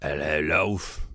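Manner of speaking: slow, low character greeting; a light impression of the villain; imitating a character. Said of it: "I forget what he says".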